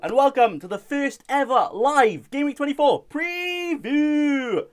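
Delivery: high, loud announcer welcome; announcer intro. Acted. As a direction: open the show like a TV presenter.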